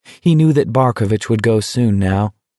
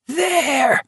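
a pitched-up shrewish cry; shrewish scream. Acted. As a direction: shriek it like a shrill nag. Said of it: "Barkovich screamed shrewishly".

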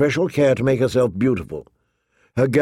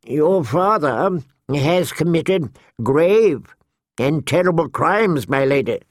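intoning; 4 semitones higher than usual. A narrator's reading. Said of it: "Grand Maester Pycelle intoned".

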